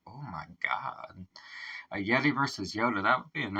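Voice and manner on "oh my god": a stunned, hushed oh my God; whispered in disbelief; awed whisper. Real, not acted. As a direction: breathe it out in a whisper. Stunned by the lyric.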